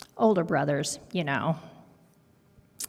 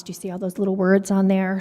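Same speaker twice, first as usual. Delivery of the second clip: usual speaking voice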